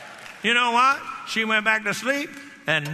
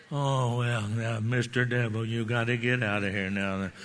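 much lower and softer, meek and unsure; timid weak plea; 7 semitones lower, 4 dB quieter than usual. Pretend. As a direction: beg the devil half-heartedly with no authority. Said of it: "they have to hear authority in your voice".

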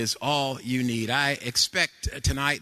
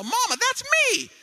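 put-on very high, loud little boy crying out; preacher imitating his grandson's delighted yell; excited toddler shout; 4 dB louder than usual. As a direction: shout it like a thrilled three-year-old. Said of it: "He told his mama".

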